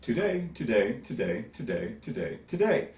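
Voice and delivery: "today" repeated, each stressed on DAY; iambic beat. Shown as a demonstration. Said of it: "That's also a natural iamb".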